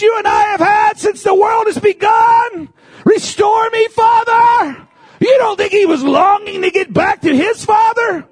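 high, loud impassioned preaching cry; fervent preaching. Acted. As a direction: cry it out to the congregation with full force. Preaching at full force, high and loud.

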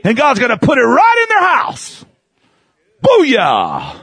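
preacher shouting the promise to the church; preacher's shout. Acted. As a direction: proclaim it at the top of your voice. Preaching peak.

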